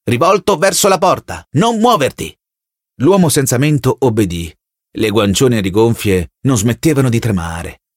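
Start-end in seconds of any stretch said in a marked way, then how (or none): 0.0-2.4 higher, stern voice barking orders over a speaker; distant authoritarian voice giving commands; commanding telescreen voice. Acted. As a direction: order him like a cold loudspeaker.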